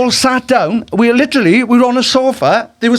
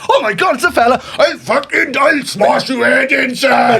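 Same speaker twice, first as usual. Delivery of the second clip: mock hard-man threat; 5 semitones higher than usual. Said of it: "I was like".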